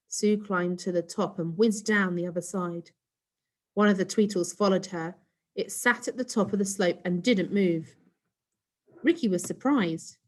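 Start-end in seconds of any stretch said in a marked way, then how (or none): none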